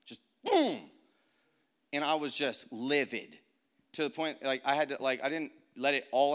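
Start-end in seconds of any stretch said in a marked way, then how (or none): 0.4-0.8 high, loud burst of anger; sudden high-pitched boom; explosive boom. Retold or imitated. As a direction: let anger erupt in a booming sound effect. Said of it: "anger, just, just came out of me".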